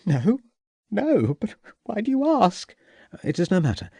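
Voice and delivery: an anxious widow asking; hostess voice. A narrator's reading. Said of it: "Mrs Pitzer answers, anxious".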